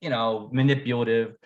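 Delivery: ordinary talk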